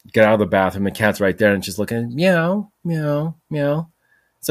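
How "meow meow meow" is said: cat meow. Retold or imitated. Cat meowing at him after bite.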